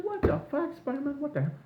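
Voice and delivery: high mock squeal of disbelief; shocked squeal. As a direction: squeak it out, stunned. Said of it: "I said".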